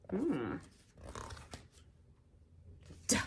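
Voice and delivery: usual speaking voice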